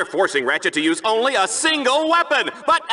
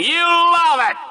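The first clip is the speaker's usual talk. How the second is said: much higher, loud gleeful yell; gloating shout; 9 semitones higher, 3 dB louder than usual. Acted. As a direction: burst out gloating. Gloating punchline after mock apology.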